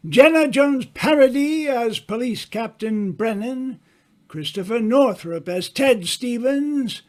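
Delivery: ordinary talk, nothing marked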